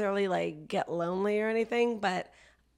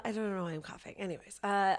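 ordinary delivery

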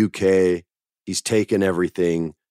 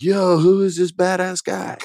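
excited crowd; 12 semitones higher than usual. Retold or imitated. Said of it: "the crowd that doesn't is like".

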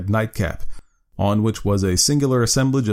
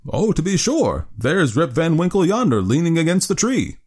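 exclaiming; 8 semitones higher than usual. Acted. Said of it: "exclaimed two or three".

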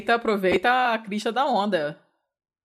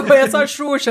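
joking mock quote; 7 semitones higher, 10 dB louder than usual. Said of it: "Você falou".

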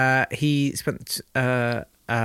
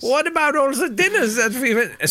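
mock Hess; 10 semitones higher than usual. Hess answering the Duke.

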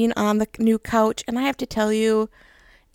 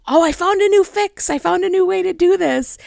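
higher-pitched retold eagerness; worked up, excited; 7 semitones higher, 3 dB louder than usual. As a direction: gush about a bright new idea, mimicking yourself. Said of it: "worked up and so excited".

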